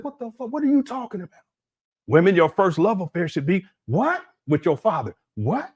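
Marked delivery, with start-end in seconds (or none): 0.0-1.4 incredulous, pitch jumping high; retold disbelief. Reacting to Umar's words, high incredulous disbelief. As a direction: recoil in shock at what he heard. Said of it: "I said".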